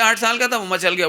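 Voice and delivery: ordinary delivery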